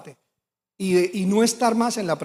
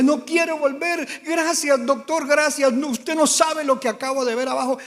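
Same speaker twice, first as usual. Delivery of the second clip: tearful pleading; 6 semitones higher than usual. Retold or imitated. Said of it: "Lloraba y decía".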